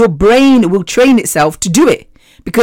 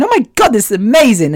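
bright burst of joy on "Oh my God"; thrilled at riding again; excited; 4 semitones higher than usual. Retold or imitated. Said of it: "imagining yourself going".

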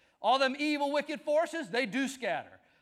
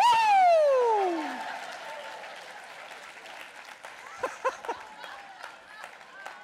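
high whooping laugh; 14 semitones higher, 5 dB louder than usual. Real, not acted. Preacher laughs after story.

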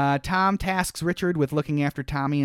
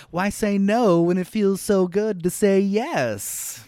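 slow, higher-pitched, weird delivery; Brian Dennehy; 6 semitones higher, slower than usual. Retold or imitated. Host imitating the father's line from the movie, weird and drawn out. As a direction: draw it out oddly, hamming it up. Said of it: "Thanks, Brian Dennehy. He did it all weird".

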